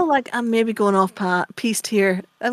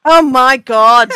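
exasperated laugh; 4 semitones higher, 10 dB louder, much slower than usual. Real, not acted. Reacting to host's joke.

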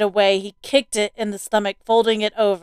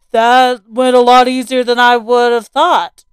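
a dad, loud and pleased with himself; smug, cheerful father; 2 semitones higher, 7 dB louder than usual. Acted.

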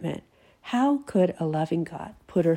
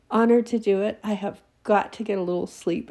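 normal talking voice

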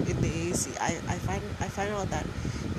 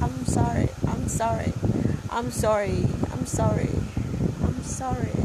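high, loud repeated sorry; playful sing-song apology; mock apology; 5 semitones higher, 4 dB louder than usual.

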